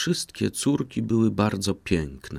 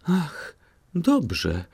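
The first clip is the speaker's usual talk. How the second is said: much higher, quick eager exclamation; eager cry; 10 semitones higher than usual. A narrator's reading. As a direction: cry out eagerly like a spoiled princess. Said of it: "zawołała królewna".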